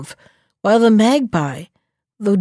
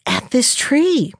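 absent voice; 5 semitones higher than usual. Acted. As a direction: say it as if barely thinking. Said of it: "answered the fox in an absent voice".

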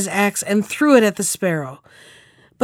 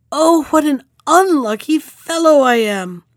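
woeful calling out; 6 semitones higher, 4 dB louder than usual. A narrator's reading. Carter losing his last horse, calling out in woe. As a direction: call out high, bemoaning your luck. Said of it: "again the carter called out".

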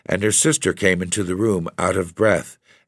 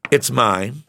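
1 proudly; 6 semitones higher than usual. A narrator's reading. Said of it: "she said proudly".

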